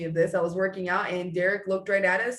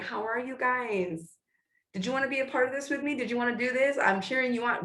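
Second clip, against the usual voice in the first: high, light, coaxing cheerleader voice; mock the sweet check-in of an upline; sugary cheerleader upline; 5 semitones higher than usual. Mocking an upline cheering on her team, high and sugary. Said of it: "somebody that's like".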